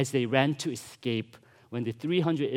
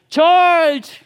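loud, high mock war cry; battle cry; 13 dB louder than usual. Preacher imagines soldiers charging, yelling a loud mock battle cry. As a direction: bellow it like a charging army.

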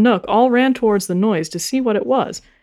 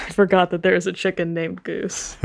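usual speaking voice

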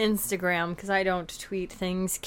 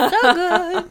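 gushing; 8 semitones higher, 5 dB louder than usual. Retold or imitated. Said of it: "and then you would be like".

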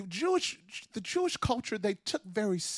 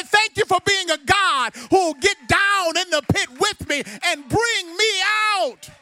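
full-force preaching; 11 semitones higher, 12 dB louder than usual. Acted. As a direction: build the church to a roar.

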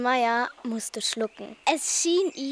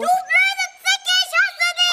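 much higher, loud whiny screech; screeching witch; 7 dB louder than usual. Acted. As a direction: shriek like a bratty witch. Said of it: "Sie kreischten sich an".

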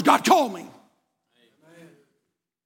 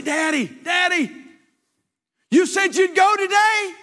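put-on little boy pleading; 10 semitones higher, 3 dB louder, slower than usual.

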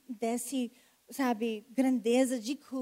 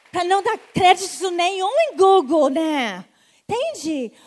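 loud, driving emphasis; loud preacher's emphasis; emphatic preaching; 5 semitones higher, 12 dB louder than usual. Real, not acted. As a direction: hammer the point home to the crowd. Preacher stresses God, not Google.

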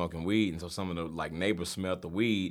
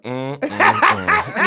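slow, drawn-out disapproving hum; disapproving dad; 9 semitones higher, 10 dB louder, much slower than usual. Retold or imitated. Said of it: "My dad was looking at me just like".

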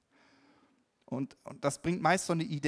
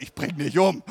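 mock threat; 10 dB louder than usual. Son imagined shouting back at his father, mock furious shout. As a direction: shout it as the angry son would. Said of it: "der hätte wahrscheinlich gesagt".

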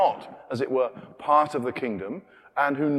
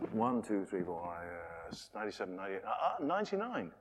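counting sheep; 7 semitones lower, 12 dB quieter than usual. Acted. Shepherd counting sheep aloud.

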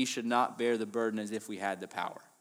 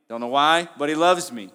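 louder, ringing out with conviction; emphatic declaration; 3 semitones higher, 10 dB louder than usual. Acted. Preacher proclaiming a believer's confidence, loud and emphatic. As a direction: proclaim it boldly to the room.

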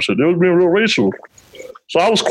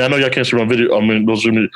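ordinary talk

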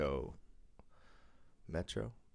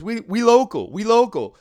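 put-on much higher, louder street-kid voice; imitated local guy; 10 semitones higher, 10 dB louder than usual. Friend insisting to the worker they are locals. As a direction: puff up like a local tough.

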